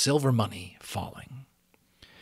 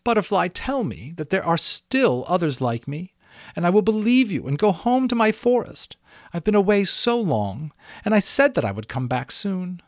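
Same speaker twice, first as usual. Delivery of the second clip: high, louder pleading unicorn voice; 9 semitones higher, 6 dB louder than usual. A narrator's reading. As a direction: beg for the truth.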